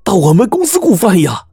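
very high, indignant outburst; hopping mad. A narrator's reading. Employee asks boss why he hired from his company, hopping mad. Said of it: "张海是气得直跳脚啊".

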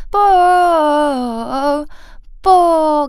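loud, high, bursting "bo"; popping bo. Shown as a demonstration. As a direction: explode each "bo". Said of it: "感觉是爆的感觉". (爆破感的bo)

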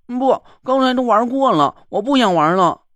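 put-on high pouting child refusing; a stubborn little doll voice; too calm creepy doll. Mud doll refuses to play. (任性的娃娃声音)

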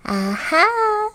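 very high, gleeful goblin aha; high-pitched little creature; smiling goblin. Acted. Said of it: "smiled the Goblin".

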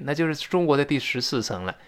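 normal talking voice

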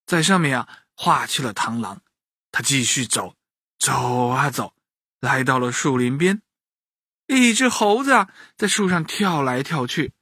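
usual speaking voice

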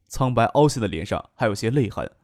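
usual speaking voice

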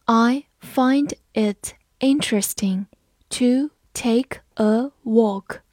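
"I find it interesting to take a walk" read very slowly, word by word; slow teacher demo read. Shown as a demonstration. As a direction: read it slow and clear for learners. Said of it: "我们慢读一遍".

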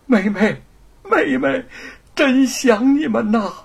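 high, slow, choked with joy; overjoyed reunion. Acted. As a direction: cry out the longing to your sister. 叶云激动重逢. (激动地高声倾诉思念)

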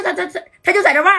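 fast, stumbling through laughter; laughing. A narrator's reading. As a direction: giggle while getting it out. Narrator cracks up at barking.

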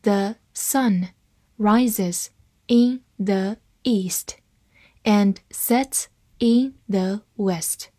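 word by word, slowly, with pauses; slow reading. Shown as a demonstration. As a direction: read it slowly for learners to follow. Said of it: "慢慢来读". (慢慢地逐词朗读)

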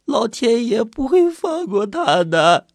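high, slow, tearful pleading; crying harder. A narrator's reading. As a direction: beg him through mounting sobs. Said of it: "李早哭得更厉害了".

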